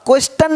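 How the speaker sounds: "क्वेश्चन" as kwes-tan; "क्वेश्चन" misread with a spelled-out t; wrong t sound. Shown as a demonstration. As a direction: say "क्वेश्चन" with hard t, not ch. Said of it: "अब क्वेश्चन नहीं बोलते".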